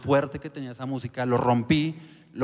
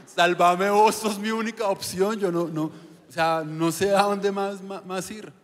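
raised, pleading cry to God; desperate plea; 7 semitones higher, 4 dB louder, slower than usual. Retold or imitated. A man retelling his plea to God, raised and pleading. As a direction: beg God to save you, voice high and straining. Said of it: "y yo le dije a Dios".

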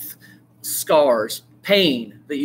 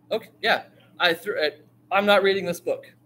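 normal talking voice

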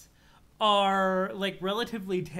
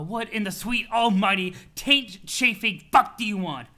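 loud, irritable troll outburst; acted exasperation; 7 dB louder than usual. Irritable troll answers an unwanted chat, acted exasperation. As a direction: punch out the insult in mock fury.

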